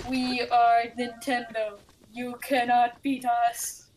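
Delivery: slow, flat robotic monotone. Retold or imitated. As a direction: intone it like a machine. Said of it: "they say in robot voices".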